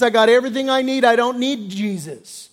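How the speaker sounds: mock swaggering rich man; smug rich man. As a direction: brag like you need nobody. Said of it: "your attitude is".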